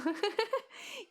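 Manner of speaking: short squealing laugh; high burst of laughter. Real, not acted. Laugh after reading chat.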